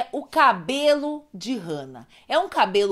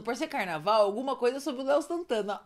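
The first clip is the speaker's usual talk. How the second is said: normal talking voice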